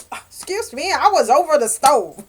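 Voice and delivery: high, then loud flustered excuse; mock defensive. Caught blushing at work, mock flustered excuse. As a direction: brush it off with a hammed-up fluster. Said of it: "It's like".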